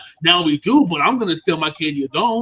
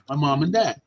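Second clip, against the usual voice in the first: normal talking voice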